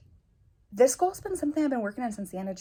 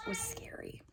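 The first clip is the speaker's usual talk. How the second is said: teary whisper; 13 dB quieter than usual. Real, not acted. Emotional goal confession.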